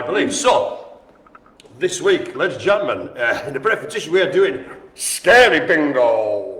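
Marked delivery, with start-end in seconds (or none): 4.9-6.6 slow, drawn-out mock-spooky title; spooky announcer. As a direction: announce it like a playful Halloween host. Announcing the Halloween-themed game.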